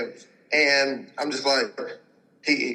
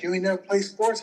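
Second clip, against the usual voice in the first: higher mock patient voice; 7 semitones higher than usual. Patient voiced in retold story.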